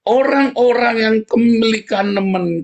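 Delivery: high, loud and slow, hammered out; loud sermon outcry; loud preaching burst. Acted. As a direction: proclaim it loud to the congregation.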